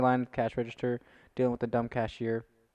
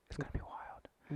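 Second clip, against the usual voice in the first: hushed whisper; 3 semitones higher, 15 dB quieter than usual. Real, not acted. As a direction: whisper it like a secret. Whispered aside.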